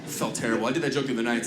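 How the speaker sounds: usual speaking voice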